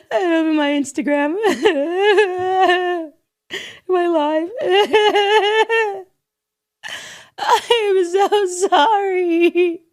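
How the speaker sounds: high, hammed-up sobbing with laughs breaking through; mock hysterical crying. Mocking a celebrity crying for her phone camera, high hammed-up sobs. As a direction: overact a weepy celebrity filming her own tears. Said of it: "you start crying hysterically".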